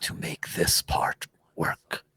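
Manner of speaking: solemn, knowing spirit whispering a warning; whispering orb spirit. Acted. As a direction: breathe it like a secret only they can hear.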